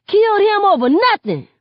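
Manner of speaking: loud, very high outraged yell; outraged shout. A narrator's reading. Guy slamming a bat into a police car, outraged shout. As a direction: shout it in furious protest.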